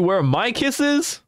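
high, slow pleading whine; whiny begging voice. Pretend. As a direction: beg like a needy pet. Begging chat for kisses.

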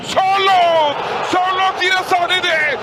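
drawn-out goal yell; screaming football commentator. Real, not acted. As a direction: stretch the name, full voice. Goal call.

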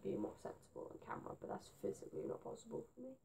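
hushed unvoiced aside; fast, whispered self-mockery. Real, not acted. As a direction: whisper it like a confession.

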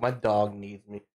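normal talking voice

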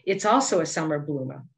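usual speaking voice

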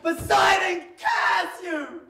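mock defiant shout; shouting back. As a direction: fling a curse back. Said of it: "shouted back".

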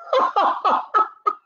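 high hammed-up burst of laughter; goat's big laugh. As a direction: laugh like a smug bully goat. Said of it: "burst out laughing".

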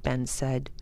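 ordinary delivery, nothing marked

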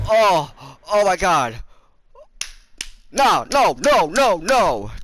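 very high, loud panicked shouts; real panic yells; terrified screaming. Real, not acted. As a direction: scream as the killer chases you. Chased by the killer.

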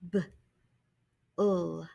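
B and ul said as separate sounds; sounding out. Shown as a demonstration. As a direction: break the word into sounds.

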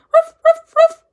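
high loud yappy barks; dog bark. Acted. Dog answering the fly, loud high barks. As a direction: bark like an eager pooch. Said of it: "barked the pooch".

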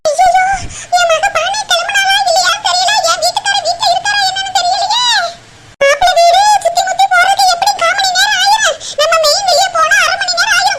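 high, loud frantic wailing; loud village mother in a frantic fluster; panicked shouting. Acted. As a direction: panic that the guests came early. Mother panics guests arrived early.

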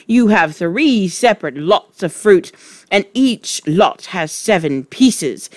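loud furious ranting; furious shouting. Acted. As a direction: rage at the boy. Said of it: "shouted the Trunchbull".

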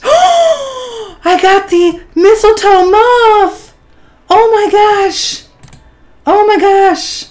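high, slow, gasping delight with a laugh; breathless delight. Real, not acted. A YouTuber shows viewers the mistletoe moth she just unboxed. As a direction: gush with a gasp and a giggle.

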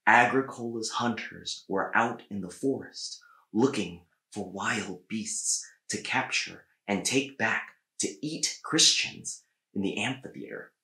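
plain reading voice

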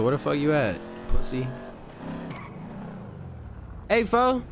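ordinary delivery

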